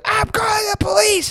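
very high, squeaking voice; a panicky falsetto warning; squeaky threat. Retold or imitated. Caught man threatens the hunters.

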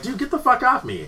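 high-pitched indignant protest; retold protest. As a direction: act out shaking off someone grabbing you. Retold shout at a grabbing man.

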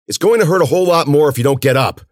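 high, loud scolding urgency; urgent warning. Acted. Cece warns hurt Ben to move, urgent shout. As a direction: push him hard to move.

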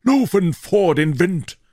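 usual speaking voice

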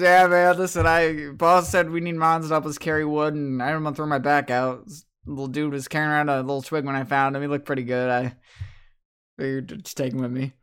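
loud, easygoing trainer voice; laid-back guy talking big; NPC voice. Acted. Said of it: "He goes".

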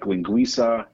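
normal talking voice

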